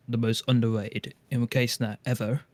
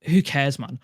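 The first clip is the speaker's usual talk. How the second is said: ordinary talk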